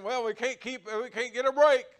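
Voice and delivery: loud, high cry of frustration; exasperated outcry. Retold or imitated. Preacher voicing his congregation's complaint, loud exasperated outcry. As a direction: throw up your hands and shout it out.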